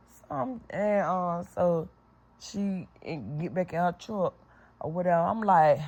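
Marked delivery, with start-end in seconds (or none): none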